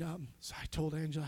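ordinary delivery

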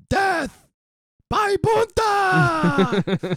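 very high, loud, long drawn-out yell. Acted. Native announcing the third guy's fate, triumphant yell. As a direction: belt out the verdict, stretching the ending. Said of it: "the one native yells".

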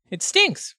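high-pitched kobold. Acted. Kobold describing a monster to party, high voice.

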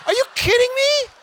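mock outrage, way up high; hammed-up shocked husband to wife; shocked disbelief. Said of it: "I'm like".

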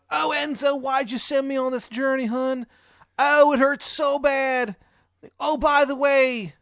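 high, loud, drawn-out wail of pain; tortured Shithead Steve. Acted. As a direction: wail it like a badly acted torture victim. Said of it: "like, tortured".

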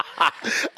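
breathy laugh that hurts; laughing in pain. Real, not acted. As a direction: laugh cut short by pain. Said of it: "Stop making me laugh".

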